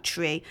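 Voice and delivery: Tree with a chr sound, low and soft; mother's Aussie Tree; broad Australian tree. Retold or imitated. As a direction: say Tree like chree, flat Aussie. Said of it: "'cause I'm like".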